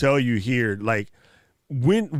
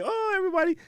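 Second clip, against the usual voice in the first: excited greeting; much faster than usual. Retold or imitated. Welcoming a guest at the barbecue.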